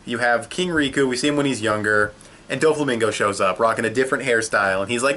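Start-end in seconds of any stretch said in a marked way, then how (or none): none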